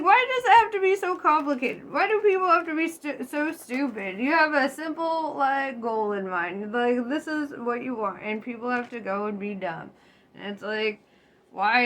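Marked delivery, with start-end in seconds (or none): 0.0-9.9 high, exasperated mock rant. Viewer fed up with stupid people, high exasperated mock rant. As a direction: rant like you're fed up with everyone. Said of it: "you're like".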